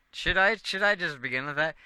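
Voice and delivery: excited. Real, not acted. Excited idea for letter.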